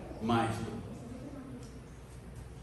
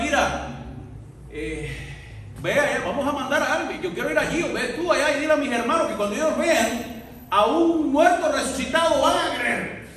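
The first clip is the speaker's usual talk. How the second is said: rich man pleading; 7 semitones higher, 4 dB louder than usual. Acted.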